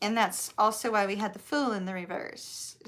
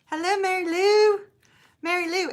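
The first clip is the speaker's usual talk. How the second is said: hammed-up Southern accent, higher and louder; 9 semitones higher, 6 dB louder than usual. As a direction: greet her like a cheerful Southern neighbor. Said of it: "I start talking with my massively southern accent".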